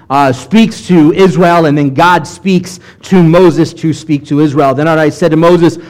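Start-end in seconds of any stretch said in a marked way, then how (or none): none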